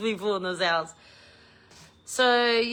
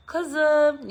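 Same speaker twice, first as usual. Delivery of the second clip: higher, louder friendly call; bright hail across a room; 8 semitones higher, 5 dB louder than usual. Retold or imitated. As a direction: call the cousin over like the good old days. Calling out to cousin.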